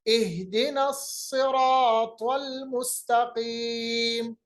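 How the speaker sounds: slow recitation, "सिरातल" with thick saad; heavy saad. Shown as a demonstration. Teacher showing students "सिरातल", heavy saad in slow recitation. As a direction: thicken the saad in "सिरातल" and hold long vowels. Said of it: "फिर भी उसको मोटा पढ़ेंगे".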